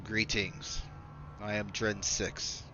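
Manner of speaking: a hint of a cold alien envoy over the intercom. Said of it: "It's a more deep, kinda huskier voice".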